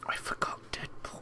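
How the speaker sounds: whisper. Real, not acted. Aside while other talks.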